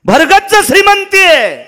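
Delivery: high ringing shout on श्रीमंती; loud sermon cry; preaching shout. Acted. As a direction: cry out the refrain to the crowd. Preacher's refrain repeated.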